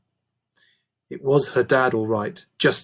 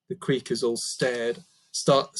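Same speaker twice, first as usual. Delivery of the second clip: plain reading voice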